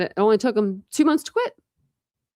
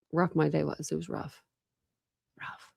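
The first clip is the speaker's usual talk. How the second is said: fading to a murmur; 2 semitones lower, 8 dB quieter than usual. Real, not acted. Trailing off about a hard day.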